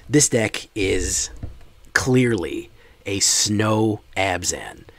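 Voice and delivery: "clearly" and "Snow Abzan" drawn out loud and slow; emphatic disbelief. Real, not acted. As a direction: spell out the objection slowly, loudly, word by word. Disputes viewer's claim about their deck.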